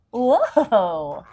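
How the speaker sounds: impressed whoa. Real, not acted. Teacher reacting for the children, excited whoa.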